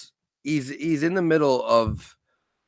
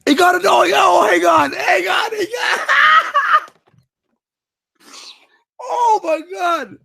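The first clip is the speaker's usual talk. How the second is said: high shrieking disbelief through laughter; laughing outburst; 5 dB louder, slower than usual. Real, not acted. Comedian repeating his co-host's line, laughing and shouting. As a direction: shout the words while cracking up.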